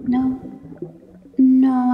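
sudden loud firm no; sharp no. Acted. Mermaid sharply stops her captive from struggling. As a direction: cut him off sharply.

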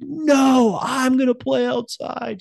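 put-on stamp your foot like a kid refusing; defiant kid. Son insisting to mom he'll play outside, high defiant shout. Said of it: "He's like".

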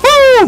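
excited whoop. Real, not acted. Dodging an attack.

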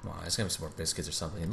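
ordinary delivery

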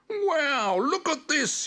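very high puppy wonder; amazed delight. A narrator's reading. Spot unwrapping his presents, high and amazed. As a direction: gasp it out high, thrilled.

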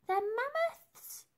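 very high, slow disbelieving cry; shocked question. Acted. Dotty startled by the mammoth plan, high and drawn out. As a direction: balk at the word mammoth's.

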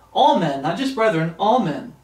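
ordinary talk

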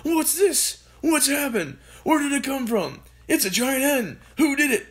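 excited father. A narrator's reading. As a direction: fire them off as a frantic father.

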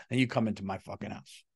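usual speaking voice

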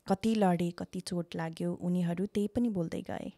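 deliberate spoken-word delivery; poem recitation. Acted. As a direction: recite your own verse, projecting each line. Recites own written poem.

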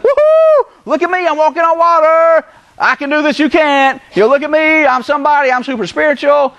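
high-pitched, whooping boastful voice; mock show-off. Preacher mocking a motive Peter didn't have, showboating. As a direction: brag like a hammed-up attention seeker. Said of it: "See, his motive wasn't".

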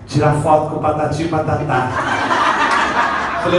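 ordinary talk

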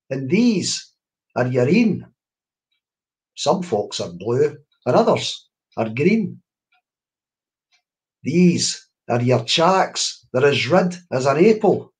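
put-on slow, measured Scots verse read aloud to children; lilting recitation of a Scots rhyme; poem recital. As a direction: savour each Scots word as you name the body parts. Poet recites Scots body poem.

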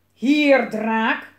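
loud commanding shout; calling out. A narrator's reading. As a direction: shout an order at a dragon. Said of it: "riep een dikke meneer".

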